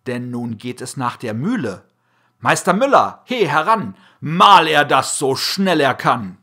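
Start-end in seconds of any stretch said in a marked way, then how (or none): 2.4-6.4 loud call across the mill yard. A narrator's reading. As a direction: shout for the miller to come over. Farmer calls to the miller.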